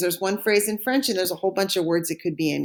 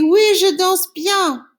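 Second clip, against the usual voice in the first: high, slow, proud oui, je danse bien; cheerful student answer; 9 semitones higher, 6 dB louder, slower than usual. Pretend. Teacher voices a student answering she dances well, high and proud. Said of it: "And the answer would be".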